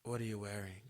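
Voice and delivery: low, soft imitation of his come-on; imitated phone-sex come-on. Man trying phone sex on her, imitated low and quiet. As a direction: murmur it low, as the caller. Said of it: "he's trying to have phone sex".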